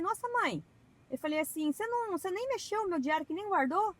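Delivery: put-on young self. Retelling herself at thirteen.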